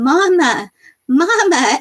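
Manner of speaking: lightly voiced toddler reaching out; baby calling mama. Mother retelling her baby calling for her, lightly imitating. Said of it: "And she starts going".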